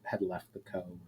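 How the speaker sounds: usual speaking voice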